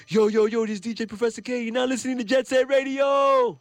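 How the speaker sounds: high, loud hype DJ announcing the station; hammed-up high-energy DJ shout; radio DJ intro. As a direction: belt out a pirate-radio intro, stretching the last word. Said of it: "he'll be like".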